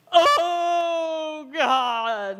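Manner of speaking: loud, high mock wail; hammed-up wail drawn out long. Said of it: "this guy starts wailing".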